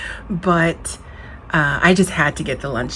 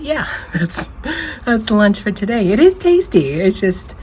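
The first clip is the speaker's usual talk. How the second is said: higher, louder, breaking into laughter; laughing while talking; 4 semitones higher, 6 dB louder than usual. Real, not acted. Signing off lunch to viewers, laughing. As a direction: talk through real laughter, voice jumping up. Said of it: "it's making me laugh".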